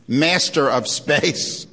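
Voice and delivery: Master of Space stretched out, stress on master; slow, weighty motto. Retold or imitated. Lecturer quoting the Space Command motto, slow and ominous. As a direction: lay it on slowly for effect. Reading the Air Force motto.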